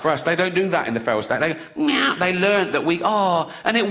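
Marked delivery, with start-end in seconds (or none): 1.7-2.1 high sharp meow; cat meow. Acted. To the audience, imitating a cat: high meow. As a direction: squeak it like a cat.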